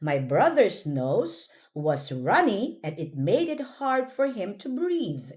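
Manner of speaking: normal talking voice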